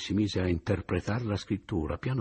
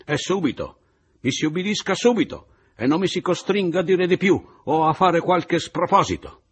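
minaccia furiosa; 11 semitones higher, 8 dB louder than usual. Acted. Malagna minaccia furibondo.

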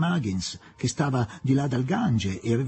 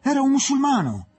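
high, loud outburst of dawning realisation; shocked exclamation; 11 semitones higher, 4 dB louder than usual. A narrator's reading. Son struck by the truth, exclaiming in high alarm. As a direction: exclaim in indignant astonishment, voice leaping up. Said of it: "colpito dalla verità ed esclamò".

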